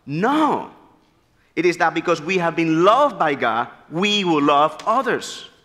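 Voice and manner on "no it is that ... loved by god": ordinary talk, nothing marked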